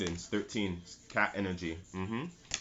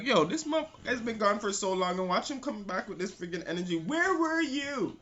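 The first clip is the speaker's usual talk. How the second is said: confused client; 12 semitones higher than usual. Retold or imitated. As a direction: whine it like someone left behind. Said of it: "You're like".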